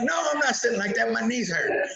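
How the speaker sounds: high, fast, put-on whiny voice; whiny refusal. Refusing to sit in lotus position, high whiny mock protest. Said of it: "I'm like".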